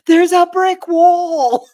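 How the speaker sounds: slow, high shout of alarm; retold shout. Friends shouting to driver about the wall, loud slow shout. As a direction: call out the warning loud and slow. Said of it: "both chimed up at the same time".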